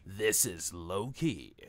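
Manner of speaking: slow, low announcer voice opening the show; show intro announcer. Acted. Host opening his video in slow announcer voice.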